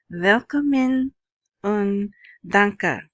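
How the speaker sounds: slow, careful German welcome and thanks; German greeting. Shown as a demonstration. Podcaster greets German-speaking listeners, slow and careful. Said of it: "That's to my German".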